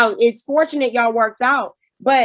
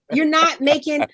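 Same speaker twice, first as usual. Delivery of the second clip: ordinary talk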